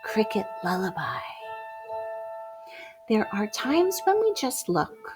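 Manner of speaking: ordinary delivery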